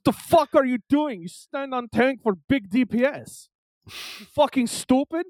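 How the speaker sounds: put-on high, loud, angry Russian accent; mocking impression of an irate Russian gamer; Russian-accented yelling. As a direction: berate teammates in a thick Russian accent. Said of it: "he's just fucking yelling at us".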